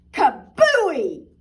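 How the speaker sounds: loud, punchy sound-effect word; excited burst. Acted. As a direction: shout the pop of the balloon. Balloon bursts in story.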